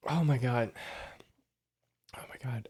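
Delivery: strained whisper from burning mouth; mouth burning from spicy food. Real, not acted. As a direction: gasp through the burn. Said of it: "It's hurting me so badly".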